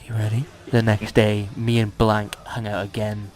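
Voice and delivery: usual speaking voice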